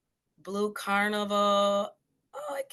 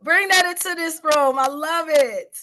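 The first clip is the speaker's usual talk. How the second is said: loud, high, rousing cheer; excited hyping up; 8 semitones higher, 8 dB louder than usual. Real, not acted. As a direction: cheer them on with big, raised energy. Host hypes up a participant's answer.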